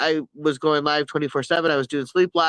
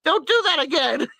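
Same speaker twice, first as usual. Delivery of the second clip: mock scolding; 11 semitones higher than usual. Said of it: "I was like".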